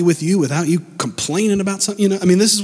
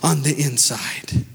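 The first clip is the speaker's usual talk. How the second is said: fervent, lifted sermon voice over music; preaching; 6 semitones higher, 3 dB louder, slower than usual. Acted. Pastor preaching to the congregation, full fervor.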